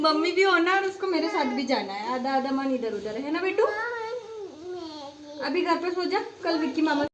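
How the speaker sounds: high-pitched coaxing. Real, not acted. Coaxing a small child at parting. (बच्चे को पुचकारते हुए, ऊँची आवाज़ में)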